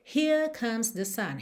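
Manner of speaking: British "Here" with no R, long vowel; "Here" as British "hee-uh", R dropped; British accent. Shown as a demonstration. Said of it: "vamos ter".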